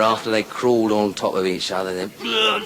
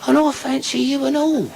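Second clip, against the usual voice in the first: put-on old woman voice. Old woman answers old man.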